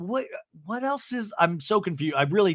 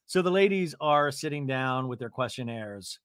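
normal talking voice

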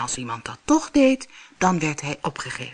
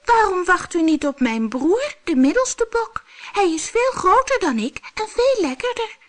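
much higher squeaky pleading; tiny goat begging, high pitched; squeaking goat; 3 dB louder than usual. Acted. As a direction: squeak it like a frightened kid goat. Little goat pleads with monster.

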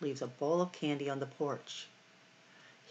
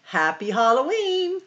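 high, loud Halloween cheer; cheerful shout; 10 semitones higher, 10 dB louder than usual. Acted. The animals greeting Farmer Brown at the party, loud cheer. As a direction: burst out with the greeting. Climax greeting ending the book.

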